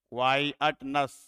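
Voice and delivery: क्वाई अट नस slowly, in three distinct beats; three separate syllables. Shown as a demonstration. Teacher proving there are three syllables, क्वाई अट नस said apart. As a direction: split it evenly as क्वाई, अट, नस. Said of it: "में तीन syllable हैं".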